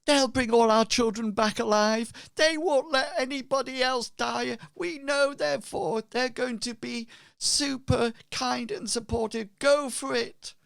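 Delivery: high-pitched, loud gushing praise; excited villager. Acted. Villager vouching for the heroes to the crowd, high excited cheer. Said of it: "And somebody says".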